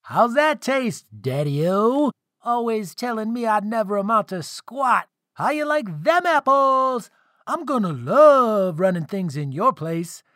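high, cocky son's taunt; gleeful gloating. A narrator's reading. Son taunting his father after shooting fire, gleeful. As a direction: crow it gleefully, pitched well up.

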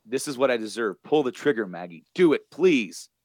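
urgent imitated plea; retold begging. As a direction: act out begging, pushing harder on each phrase. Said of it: "He's begging her".

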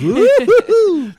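very high, loud slow whoop; shock jock radio whoop. Pretend. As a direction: whoop like a hyped DJ. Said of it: "my stupid shock jock radio voice".

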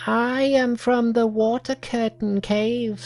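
high, odd little monkey voice. Acted. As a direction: speak as an eager young monkey.

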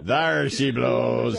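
put-on slow old-sea-captain cry; slow sailor call. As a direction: draw it out like a whaler spotting a whale. Whaling call joke.

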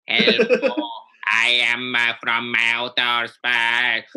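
slow, stilted alien greeting. Acted. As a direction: speak like a spaceman from outer space. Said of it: "This is how they talk".

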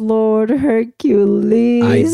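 a long, dragged-out, fed-up cry to the heavens; exasperated drawn-out plea. Real, not acted. Wife fed up with husband's lesson, drawn-out exasperation. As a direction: stretch Hercules out slowly in exasperation. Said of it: "exasperated exclamation".

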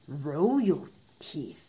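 low regal king; king's voice. Acted. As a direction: say it like a proud king. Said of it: "said the king".

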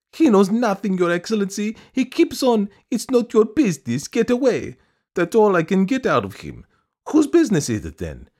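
very high, loud complaining woman; high-pitched, indignant supplicant; aggrieved petitioner. A narrator's reading. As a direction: protest in a piping, insistent voice.

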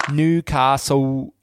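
slow, drawn-out Geordie Newcastle; mock Geordie drawl; Geordie accent impression. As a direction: stretch it out in her Geordie accent. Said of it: "Don't be a Cockney geezer".

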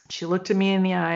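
normal talking voice

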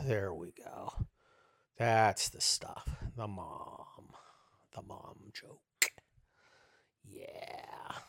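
slow, low, hushed gloating; savouring. Pretend. Host relishes his mom joke, quietly savouring. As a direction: sink into it softly, mock bliss.

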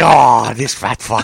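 slow mock exasperation; drawn-out, put-on annoyance; mad order-taker. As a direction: grumble it slowly like an annoyed clerk. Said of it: "and they get mad".

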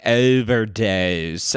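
Philly O's hit way too hard in "overdose"; slow, exaggerated Philadelphia O's in "overdose"; overdone Philly accent. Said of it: "hitting her O's way too hard".

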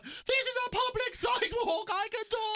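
high, loud indignant protest; mock outrage at the guards; hammed-up defiant yell, pitched high; indignant retort. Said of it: "And I'm like".

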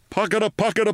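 high rhythmic pocketa like a machine; mechanical pocketa imitation. A narrator's reading. Narrator imitating the flamethrower, high rhythmic chug.

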